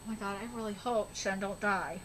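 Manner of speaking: real hushed plea; hushed hope. Real, not acted. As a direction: murmur a worried prayer.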